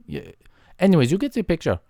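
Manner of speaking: ordinary talk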